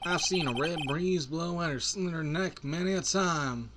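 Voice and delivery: drawn-out, put-on Western voice; cowboy lawman. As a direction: play a cowboy with an easy drawl. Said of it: "I'll give him that voice instead".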